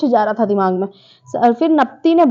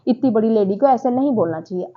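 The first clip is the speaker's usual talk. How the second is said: usual speaking voice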